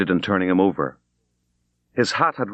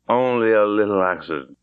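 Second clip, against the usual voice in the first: speaking thickly. Acted. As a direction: mumble it through a drunk, heavy tongue. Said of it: "He spoke very thickly".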